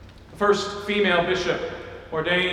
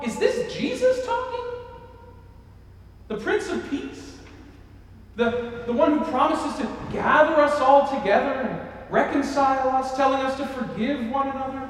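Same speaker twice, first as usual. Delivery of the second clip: incredulous; 6 semitones higher than usual. Retold or imitated. Voicing shocked listeners.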